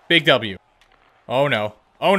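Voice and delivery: ordinary talk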